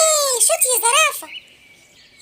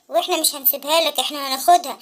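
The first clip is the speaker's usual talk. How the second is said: much lower, threatening beast; menacing tiger voice; 11 semitones lower than usual. Acted. Tigers bully the boy off his bike, low. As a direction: growl the threat down at him.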